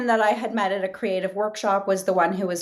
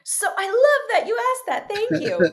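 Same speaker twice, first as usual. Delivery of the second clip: high-pitched, excited answer; light up, thrilled to be asked; real delight; 10 semitones higher than usual. Real, not acted. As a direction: gush it high and happy. Delighted by the sequel question.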